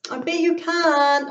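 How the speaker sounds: high, loud challenge; playful shouted dare; shouting. Acted. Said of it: "I shouted".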